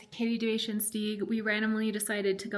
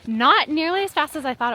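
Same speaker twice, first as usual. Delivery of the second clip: excited; 6 semitones higher, 7 dB louder than usual. Real, not acted. Thrilled after dog sled.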